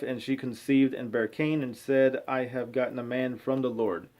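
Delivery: ordinary talk, nothing marked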